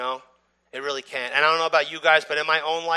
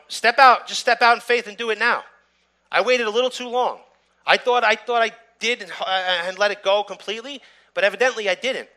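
louder and higher, pressing the listeners to step out in faith; preaching at full force; 7 semitones higher, 6 dB louder than usual. Acted. Pastor urging believers to forgive now, fervent preaching. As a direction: preach it, urge them on.

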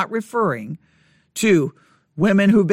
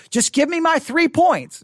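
mock impatient demand, higher and slow; impatient listener; 8 semitones higher than usual. Preacher imitating an impatient congregant, higher and slow. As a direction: play the restless listener who wants the bullet points. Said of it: "I know you're like".

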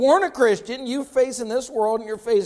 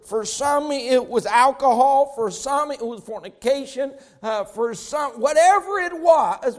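higher, louder, driving preacher's cadence; fiery preaching; 4 semitones higher, 3 dB louder than usual. Acted. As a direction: preach it hard to the church. Preaching at full force.

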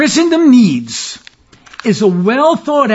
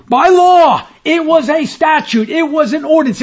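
high, raised voice punching each legal term; emphatic preaching; 7 semitones higher than usual. Real, not acted. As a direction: drive it home from the pulpit, pitched high. Preacher hammering home an indictment.

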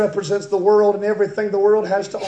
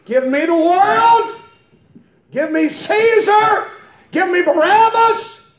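high, loud cry, rising at each end; mob-like shouted demand; 11 semitones higher, 7 dB louder than usual. Acted. Preacher voicing those who choose Barabbas, shouting. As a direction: hurl each demand like a crowd.

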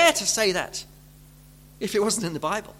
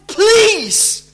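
pleading God; 10 dB louder than usual. Retold or imitated. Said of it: "he says to them".

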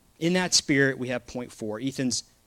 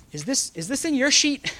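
mock disbelief; 10 semitones higher than usual. Preacher mock-baffled by a lyric.

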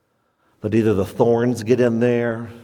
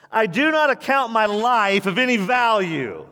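higher, steady quoted Paul; Paul's voice; 9 semitones higher than usual. Retold or imitated. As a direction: proclaim Paul's words resolutely. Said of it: "Here's his response".

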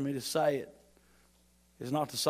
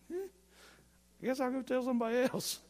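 put-off gossip; 9 semitones higher, 4 dB quieter, faster than usual. Retold or imitated. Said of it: "They're gonna be like".

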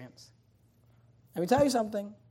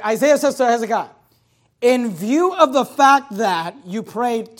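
loud, rising preacher's cadence, slowing on key words; full-force preaching; 6 semitones higher, 10 dB louder than usual. Acted. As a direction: drive the scripture home to the congregation. Preacher expounds the verse forcefully.

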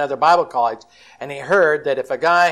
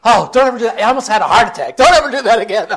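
high, loud, half-laughing rebuke; wife scolding husband for the scare; 7 semitones higher, 8 dB louder, faster than usual. Retold or imitated. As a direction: tell him off after the fright.

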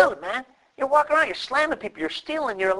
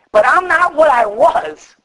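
emphatic declaration; 9 semitones higher, 7 dB louder, slower than usual. Acted. Preacher declaring growth.

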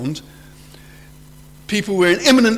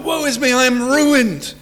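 acted anguished cry; 7 semitones higher than usual. Preacher acting out Isaiah before God, anguished crying out. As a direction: cry out in despair, slowing at the end. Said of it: "He cries out".

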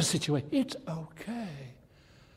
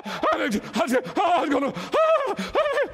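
higher, louder, slow terrified stammer; mock panicked stammer; 4 dB louder, slower than usual. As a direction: stumble over the words in fright. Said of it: "I would scream and scream".

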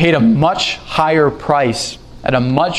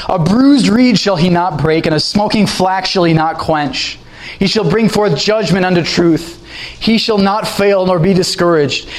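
raised, ringing scripture recitation; scripture reading; 3 semitones higher than usual. Acted. Preacher to congregation, reading Isaiah in a raised proclaiming voice. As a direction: lift the verse up and proclaim it.

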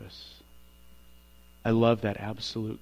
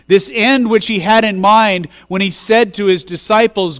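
louder, higher preaching build through the scripture; full-force preaching; 7 semitones higher, 13 dB louder than usual. Acted. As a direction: preach it out to the whole congregation, louder.

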